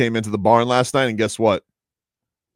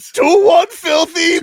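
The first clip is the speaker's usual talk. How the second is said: loud, slow mock Finnish accent, pitched way up; 11 dB louder, much slower than usual. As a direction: shout it like a Finnish hockey player. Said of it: "but in a Finnish accent".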